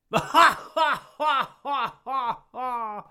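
loud open-mouthed guffaw; hearty guffaw demo. Shown as a demonstration. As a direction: let out a big guffaw. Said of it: "Then there's the guffaw".

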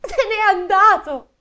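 high, loud, slow wail; hammed-up burst of crying; bursting into tears. The Sperelli wailing that the waiter has gone, mock crying. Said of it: "scoppiò a piangere la Sperelli".

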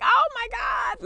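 very high, slow joyful cry; overwhelmed shout. Retold or imitated. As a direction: cry out with overflowing joy. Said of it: "And I was just like".

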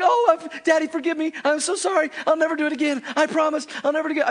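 put-on high, pleading little boy; pleading child. Repentant son begging his father for forgiveness, high pleading. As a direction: beg like a sorry kid. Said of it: "he's like".

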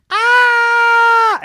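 high, loud sustained shriek; roller coaster scream. Acted.

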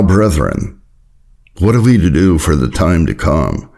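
normal talking voice

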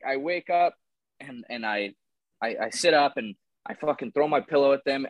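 usual speaking voice

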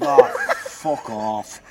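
loud, high, very drawn-out outburst; mock exasperated yell. Comedian answers offended teachers about colouring in, loud drawn-out yell. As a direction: stretch each word in a big exasperated cry.

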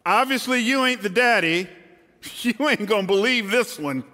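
high mock confession to Joseph; imagining Mary. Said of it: "imagining a conversation I'm gonna have with my betrothed".

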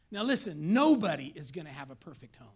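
usual speaking voice

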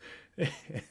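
a short, genuine burst of laughter; real laugh. Real, not acted. Host recalling a funny cartoon scene, genuine laughter. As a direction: crack up at the memory.

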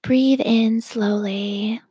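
ordinary talk

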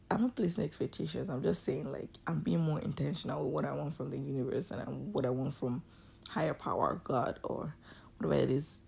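ordinary delivery

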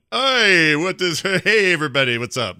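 Bwamsamdi's breezy greeting. Retold or imitated. Death loa Bwamsamdi strolling in, cheery loud greeting. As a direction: greet everyone like a party guest. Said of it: "Bwamsamdi shows up, awesome as ever, going".

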